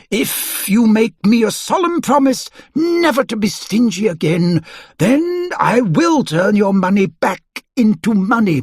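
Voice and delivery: put-on a fussy old wizard delivering judgement. A narrator's reading. Wizard voicing judgement on Mister Stingy.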